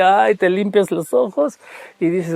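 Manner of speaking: normal talking voice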